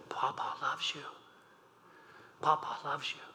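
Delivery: soft, hushed grandpa coo; tender cooing. Retold or imitated. Grandfather cooing to grandchild on his lap, soft and tender. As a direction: murmur it to a baby on your lap.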